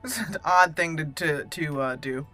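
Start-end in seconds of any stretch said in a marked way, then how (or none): none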